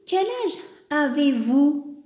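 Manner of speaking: slow, polite question; polite question to an elder. Shown as a demonstration. Teacher acting out politely asking an older person their age. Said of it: "On lui dit".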